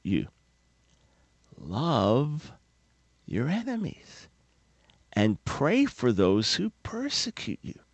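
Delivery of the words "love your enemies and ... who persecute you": soft, slow, deliberate words; hushed repeat. Shown as a demonstration. Preacher softly repeating the command to listeners. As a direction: breathe the command gently. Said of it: "repeats command softly".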